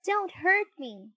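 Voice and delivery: high, loud frightened cry from the wood; a small voice begging not to be hurt; high loud plea. A narrator's reading. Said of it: "a voice cried out".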